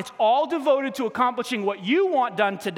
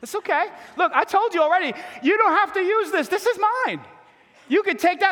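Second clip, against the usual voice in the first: high-pitched, teasing protest; playful mock defensiveness; 9 semitones higher, 3 dB louder than usual. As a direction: jokingly defend yourself, voice pitched well up. Mock defence to the unconvinced congregation.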